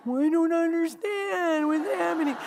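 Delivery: mock-crying bewilderment; embarrassed whine. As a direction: whine it helplessly. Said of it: "I am so embarrassed".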